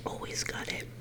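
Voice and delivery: ordinary delivery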